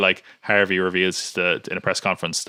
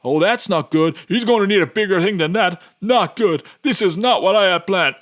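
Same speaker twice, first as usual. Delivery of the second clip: much higher, louder hammed-up fretting; cliche truck guy; 10 semitones higher, 5 dB louder than usual. Truck guy comments on the action, in high, loud mock worry. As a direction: overplay a bit-part man watching the action go wrong. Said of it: "this asshole in the truck being like".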